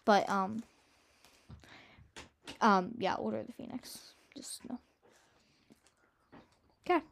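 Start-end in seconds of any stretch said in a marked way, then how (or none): 3.1-4.8 hushed dismissive whisper. Real, not acted. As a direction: whisper it with disgust. Dismissing a book in aside.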